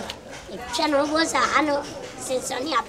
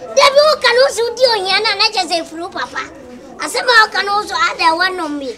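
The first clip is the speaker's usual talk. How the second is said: angry shout; 6 semitones higher, 6 dB louder than usual. Acted. Heated argument over the father.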